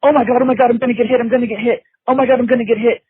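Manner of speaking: high, loud, fast panic about getting hit; panicked inner voice. Retold or imitated. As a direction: blurt it out like you're sure the blow is coming. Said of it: "your mind is going".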